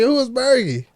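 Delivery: high-pitched puzzled question; mock clueless stranger. Rapper mimicking a confused searcher, high mock question. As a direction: play someone who never heard the name. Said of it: "they gonna be like".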